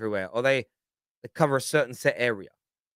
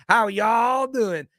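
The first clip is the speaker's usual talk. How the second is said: slow, drawn-out mock Alabama drawl, pitched up; Southern American accent; 8 semitones higher, 4 dB louder, much slower than usual. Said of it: "with a Southern American accent".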